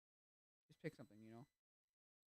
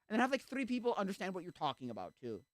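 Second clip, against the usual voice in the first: louder, higher indignant rant; mock complaint; 6 semitones higher, 6 dB louder than usual. Attendee complaining about the pastor, indignant rant. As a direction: build up a fed-up grumble. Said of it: "It's like".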